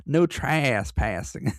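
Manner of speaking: playful backwoods twang; mock country accent. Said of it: "That's a cool accent you got there".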